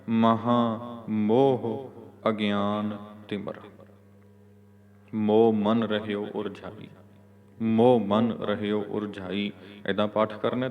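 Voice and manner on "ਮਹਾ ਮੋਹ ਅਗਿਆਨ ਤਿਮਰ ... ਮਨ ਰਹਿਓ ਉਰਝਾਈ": very slow word-by-word reading, long rest after ਤਿਮਰ; measured model recitation halting at ਤਿਮਰ; pause after ਤਿਮਰ. Shown as a demonstration. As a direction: space each word, then stop at ਤਿਮਰ. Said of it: "ਤਿਮਰ ਤੇ ਵਿਸ਼ਰਾਮ ਦੇ ਕੇ".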